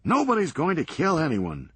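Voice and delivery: brisk, sardonic comeback; wry Serge. Acted. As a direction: toss it off dryly, quick. Serge comforts Doug.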